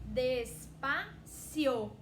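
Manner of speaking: despacio stretched out very slowly; teacher modeling a Spanish word slowly; slow syllable demo. Shown as a demonstration. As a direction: spell it out slowly in sound. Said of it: "não separam".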